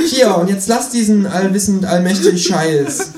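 high, cheeky kangaroo voice. Acted. Reading aloud, the narrator voices the Kangaroo asking him to pay, high and cheeky.

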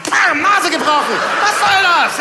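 mock shout. Comedian yelling the broken-nose punchline to audience. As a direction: yell the punchline.

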